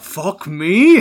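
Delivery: high, loud exasperated curse; mock principal outburst. Principal answering the pupil who swore at him, loud mock curse. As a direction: blurt it like a fed-up headteacher. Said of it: "And he's like".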